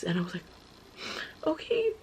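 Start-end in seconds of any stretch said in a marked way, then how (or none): 1.5-1.9 much higher timid okay; nervous high agreement to her sister; mock squeaky okay. Retold answer to sister.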